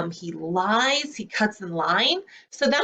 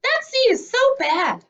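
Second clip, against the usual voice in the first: high, louder mocking seed voice; other seeds sneering; 4 dB louder than usual. Other seeds gossip about the bad seed, high mocking. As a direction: squeak it out like a snooty seed. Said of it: "they look at me and they say".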